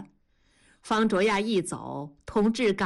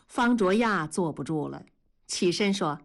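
ordinary delivery

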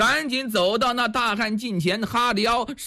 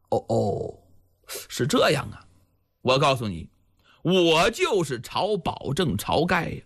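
low, measured hero's voice; lightly voiced Chao Gai; 6 semitones lower than usual. Village chief revealing himself to the stranger, lightly voiced in a low tone. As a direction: pitch it lower, as an unhurried village chief.

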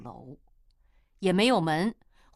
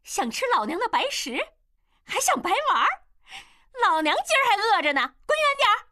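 high-pitched, loud scolding; shrill-pitched tirade driving someone off; furious cursing woman; 5 dB louder, faster than usual. A narrator's reading. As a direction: curse him out at the top of the voice. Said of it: "一个妓女出来冲他大骂".

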